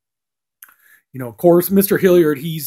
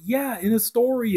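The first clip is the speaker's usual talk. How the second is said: a higher voice, lightly played; high mock reply; 10 semitones higher than usual. Wednesday explains to her parents, in a higher voice. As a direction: toss off the answer in a higher voice. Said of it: "it's like".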